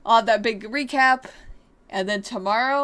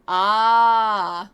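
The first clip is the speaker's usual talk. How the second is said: realizing gasp; 6 dB louder than usual. Acted. Sudden realization in dialogue.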